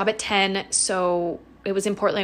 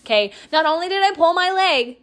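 ordinary talk, nothing marked

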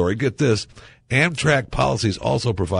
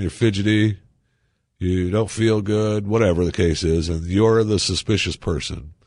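ordinary talk, nothing marked

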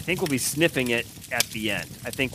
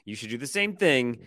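ordinary delivery

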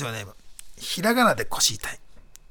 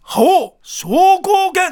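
high, slow shouted attack name; special move shout; 14 semitones higher, 7 dB louder, much slower than usual. Acted. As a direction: bellow the super move like a game fighter. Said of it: "どうしたんすか？".

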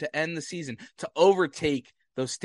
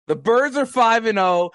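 excited shout; 5 semitones higher, 7 dB louder than usual. Real, not acted. Excited repeat of team record.

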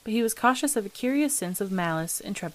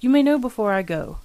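louder, mildly gruff retort; 3 semitones higher, 6 dB louder than usual. A narrator's reading. Father puts off his son's question, mildly gruff. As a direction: answer with a touch of gruffness. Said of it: "returned the other gruffly".

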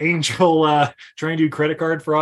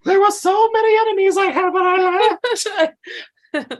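hammed-up action hero; 3 dB louder than usual. Riffing on the movie's revenge.

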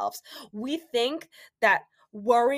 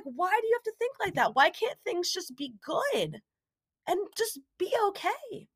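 pleading complaint; 8 semitones higher than usual. Retold or imitated. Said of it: "I literally yelled at him".

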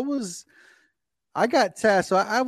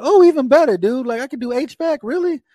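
excited; 8 semitones higher, 7 dB louder than usual. Retold or imitated. Retells his delight at H vac.

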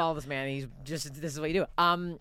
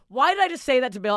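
higher, louder mortified cry; loud self-reproach; 7 semitones higher, 10 dB louder than usual. Retold or imitated. Comic after the gaffe to Bill, loud mortified wail. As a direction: scold yourself in horror.

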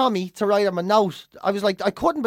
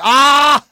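a loud, higher mock scream of horror; 6 semitones higher, 11 dB louder than usual. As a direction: shriek in hammed-up dread at the joke. Imitated dread at a bad joke.

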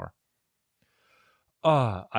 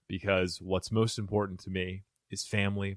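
ordinary delivery

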